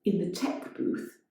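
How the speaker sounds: ordinary delivery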